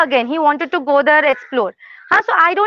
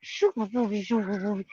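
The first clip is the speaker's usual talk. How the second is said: cooing; 6 semitones lower, 11 dB quieter, slower than usual. Real, not acted. Soft cooing to the dog.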